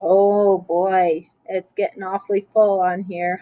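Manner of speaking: ordinary delivery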